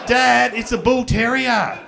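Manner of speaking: put-on slow, high, loud kid voice; loud drawn-out kid complaint; protesting kid. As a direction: whine it out slowly. Child protesting.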